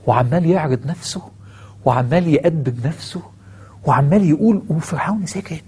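ordinary delivery

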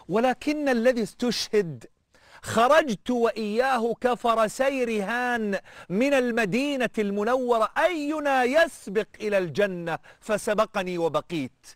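high, slow and tearful, a preacher voicing a weeping man; tearful quote. Preacher voicing the weeping captive to the Roman king, high and tearful. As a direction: lift the voice and let grief stretch the words. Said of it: "انت بتفكر انا بعيط".

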